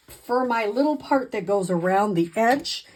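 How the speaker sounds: ordinary talk, nothing marked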